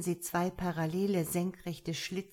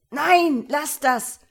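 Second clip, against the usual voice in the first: much higher, louder scream of protest; 9 semitones higher, 12 dB louder, slower than usual. A narrator's reading. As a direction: scream to make them stop. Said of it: "schrie der Anzugträger".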